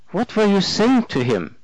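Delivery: high curious German man; German accent. A narrator's reading. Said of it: "three months over from Germany".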